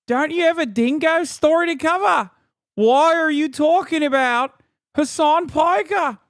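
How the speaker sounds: high, loud caricature Aussie; mock Australian accent. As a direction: ham up a broad Australian accent. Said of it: "Is that you or her talking?".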